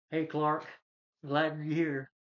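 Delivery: hammed-up hearty welcome; hearty greeting. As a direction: call out a hello, lightly acted. Acts Cousin Eddie's greeting on arrival.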